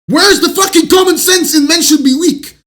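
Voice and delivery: high, loud outraged yell; angry shout. Real, not acted. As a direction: shout it in disbelief. Outraged rant at an idea.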